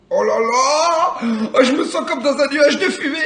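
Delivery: high, laughing, giddy; giggling. Real, not acted. Giggly high after smoking. (rire aigu et euphorique)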